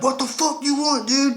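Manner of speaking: hard aggressive working-class bark; skinhead voice. Retold or imitated. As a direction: snap it out like a tough thug. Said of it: "make Brayden sound like a skinhead".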